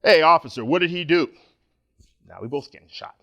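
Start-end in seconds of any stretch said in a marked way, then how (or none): 0.0-1.3 loud, forceful challenge to the officer; command presence. Retold or imitated. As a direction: demand answers like you own the scene. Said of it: "use some of that old command presence".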